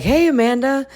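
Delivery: high, eager hello imitating him; put-on excited high voice; mock excited greeting. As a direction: perk way up as if thrilled by the call. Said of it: "he gets all excited".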